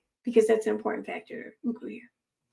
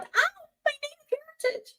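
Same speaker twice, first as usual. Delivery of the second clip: much higher, wailing mock cry; mock pained yell; 6 dB quieter than usual. Reading Johnny Depp's line, she gives a hammed-up pained wail. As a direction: cry out like he's been hit. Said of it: "He then yelled".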